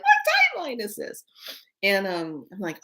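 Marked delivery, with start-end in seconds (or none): 0.0-1.2 very high squeaky disbelief. Retold or imitated. Asking which timeline, a very high bewildered squeak. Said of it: "So I'm all like".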